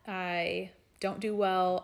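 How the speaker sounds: ordinary talk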